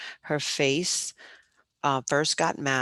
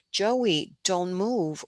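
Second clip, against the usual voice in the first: raised-pitch, restrained holler; 5 semitones higher, much slower than usual. A narrator's reading. Teacher warns the boy on the rafter, mild high holler. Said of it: "she hollered and waved her hands".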